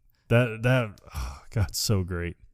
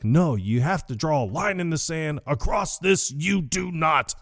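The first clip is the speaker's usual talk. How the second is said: Walter impression; 7 semitones higher than usual. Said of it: "Walter's just so funny 'cause he's just like".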